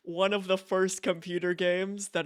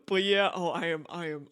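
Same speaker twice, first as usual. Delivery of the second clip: ordinary delivery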